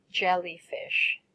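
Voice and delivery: Jellyfish said slowly, syllable by syllable, for the class to copy; careful teacher's model of Jellyfish, slow and distinct; slow model word. Shown as a demonstration. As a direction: draw out Jellyfish clearly and slowly, JEL-ly-fish. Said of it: "first I will say the words and then you will say it after me".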